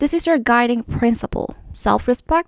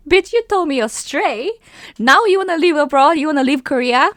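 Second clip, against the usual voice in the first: indignant retort; 4 semitones higher, 4 dB louder than usual. Retold or imitated.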